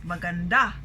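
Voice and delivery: maganda with the final DA stressed; magan-DA, weight at the end; stress on last syllable. Shown as a demonstration. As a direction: land firmly on DA in maganda. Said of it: "our syllable stress there is the last".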